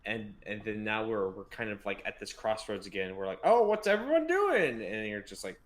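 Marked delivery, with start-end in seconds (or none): 3.4-4.8 high, loud put-on voice; mock clueless question. Co-host voices lost readers back at a crossroads, high mock-clueless.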